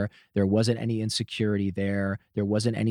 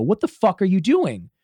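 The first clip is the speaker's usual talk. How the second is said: higher, louder retold outburst; raised voice; 10 semitones higher, 5 dB louder than usual. Demanding an answer from someone on the sofa, raised voice. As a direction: retell it with your voice raised. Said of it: "I raise my voice and I go".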